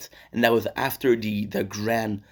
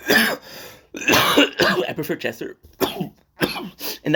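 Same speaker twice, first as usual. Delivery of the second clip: coughing fit; 7 semitones higher, much slower than usual. Real, not acted. Coughs mid-sentence.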